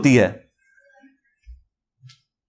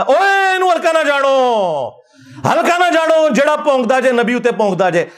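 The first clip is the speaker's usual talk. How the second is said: mimicked rousing Punjabi cleric, pitched up; Khadim Rizvi; 6 semitones higher than usual. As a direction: imitate a preacher calling out high, stretching the phrase endings. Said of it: "मैं फिर ओ खादिम रजवी साहब वाली गल करांगा".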